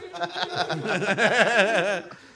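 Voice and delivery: loud long laugh; loud laughter. Real, not acted. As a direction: burst out laughing. Laughing after the bit.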